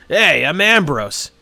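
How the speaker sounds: loud, slow cheerful greeting; cheerful shout. Acted. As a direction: call out a hearty welcome. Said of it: "he shouts cheerfully".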